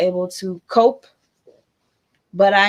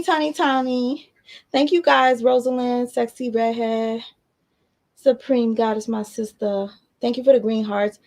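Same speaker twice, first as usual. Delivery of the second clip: ordinary delivery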